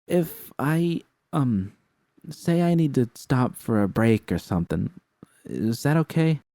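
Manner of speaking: timid new hire asking carefully; shy young woman. Acted. The shy woman asks again.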